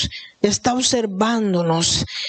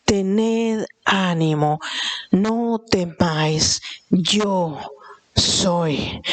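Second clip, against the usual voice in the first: gentle Jesus; 3 semitones lower, much slower than usual. Retold or imitated. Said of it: "también nos está diciendo".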